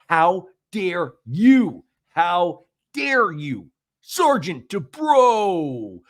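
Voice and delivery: high, drawn-out indignation; mock outrage. Podcaster mocks an affronted sergeant, high and slow mock outrage. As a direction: stretch every word in hammed-up high outrage.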